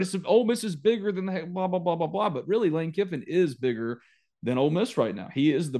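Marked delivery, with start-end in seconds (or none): 0.0-2.3 scoffing disbelief, pitch jumping high; mock outrage. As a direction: play the stunned 1995 fan, pitched way up.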